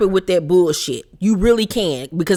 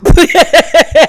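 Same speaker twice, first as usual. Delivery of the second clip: big loud laugh, much higher; loud burst of laughter; 10 semitones higher, 9 dB louder than usual. Real, not acted. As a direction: crack up out loud. Outrageous quote in recap.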